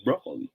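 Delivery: "Broccoli" said as BROH-ko-lee; soft "Broccoli" with a long O. Shown as a demonstration. Teacher repeats the other option, long-O broccoli. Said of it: "if it is broccoli".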